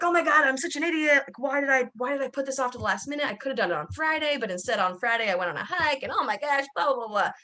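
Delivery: high, rushed panicky rant at herself; panicked self-talk. Retold or imitated. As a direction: replay the freak-out, frantic and self-scolding. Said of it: "I just started freaking out".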